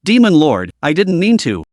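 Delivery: ordinary delivery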